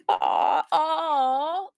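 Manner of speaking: touched aw. Real, not acted. Viewer buys her piece.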